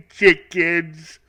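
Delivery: high cartoon-dad voice; Homer Simpson. Homer Simpson voice repeats the punchline, cartoony. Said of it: "Homer Simpson".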